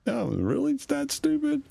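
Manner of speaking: high, mocking disbelief; sarcastic shock at the game; incredulous.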